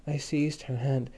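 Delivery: usual speaking voice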